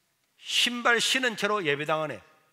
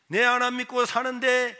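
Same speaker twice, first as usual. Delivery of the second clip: usual speaking voice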